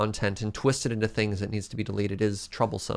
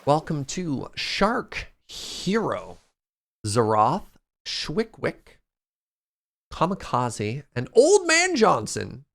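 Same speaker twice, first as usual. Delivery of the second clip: announcer roll call; 4 semitones higher, slower than usual. Acted. Announcing sub lottery winners.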